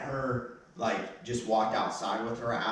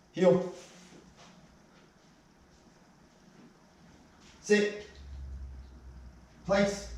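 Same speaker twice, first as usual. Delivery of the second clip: sharp commands; 11 semitones higher, much slower than usual. Real, not acted. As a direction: call each command out firmly. Trainer commanding dog.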